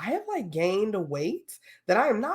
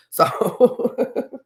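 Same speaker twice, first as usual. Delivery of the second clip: laughing; 6 semitones higher, 4 dB louder than usual. Real, not acted. Laughs at own joke.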